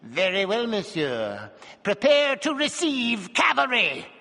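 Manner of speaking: menacing dwarf. A narrator's reading. Dwarf calmly threatening strongman.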